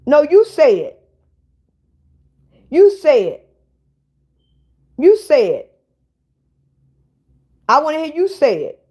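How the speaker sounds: loud, insistent challenge; demanding. Real, not acted. Daring the troll on the live to say it, loud. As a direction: push him, make him say it.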